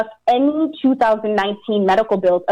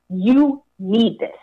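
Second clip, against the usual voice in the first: slow, emphatic; much slower than usual. Retold or imitated. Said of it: "we were told".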